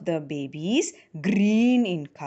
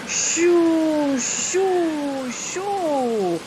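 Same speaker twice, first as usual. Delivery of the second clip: high, hushed fading shoo of wind; wind whoosh; 7 semitones higher, 4 dB louder, much slower than usual. A narrator's reading. Storyteller makes the wind blow, fading shoo. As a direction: blow the words like a gust.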